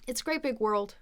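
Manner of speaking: quick, blended phrase; said fast. Shown as a demonstration. Teacher shows how fast speech blends, quick. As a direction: run the words together quickly. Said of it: "saying it that fast".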